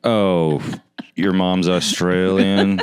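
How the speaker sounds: slow, drawn-out mock interest; sarcastic. Host performing on request for guest, sarcastic. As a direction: stretch it out with heavy sarcasm. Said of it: "Say something sarcastic".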